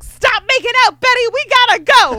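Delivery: high, loud hammed-up shout; mock yell at Betty. Veronica yelling at Betty to stop making out, mock shout. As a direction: bark the order at the kissing couple. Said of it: "she's like".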